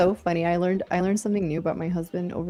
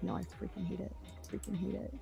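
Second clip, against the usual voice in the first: whispering; 16 dB quieter than usual. Retold or imitated. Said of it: "but he's like".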